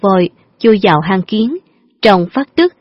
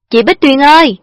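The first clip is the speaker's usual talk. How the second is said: high, loud call across the street; 7 semitones higher, 4 dB louder than usual. A narrator's reading. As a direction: shout her name to get attention. Boy calls out to her.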